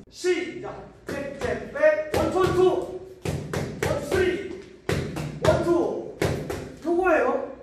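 high, loud shouted gym commands; barking gym coach. Real, not acted. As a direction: bark each punch like a coach. Coach calling punch combos.

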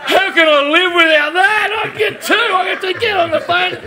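mock frantic shout. At two in the morning buying gym gear, frantic laughing shout. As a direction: shout like a hooked late night shopper. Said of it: "and I'm like".

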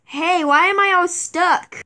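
normal talking voice

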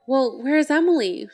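narrated shout, held back a little; mildly acted shout. A narrator's reading. Narrator as Catherine confronting David about Emily, mildly acted shout. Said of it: "shouts Catherine".

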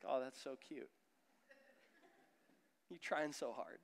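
soft, quiet, fond voice; gently indulge a small child; tender amusement. Retold or imitated. God amused by a child's effort, soft and tender.

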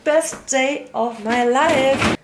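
slow, drawn-out happy exclamation; delighted. Real, not acted. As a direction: savour each word, overjoyed. Finding leftovers she craved.

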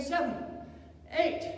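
normal talking voice

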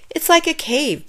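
ordinary delivery

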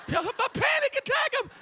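high, slow squeal; hammed-up frightened lady; mock panicked lady. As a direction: imitate her panic. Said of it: "mimicking lady".

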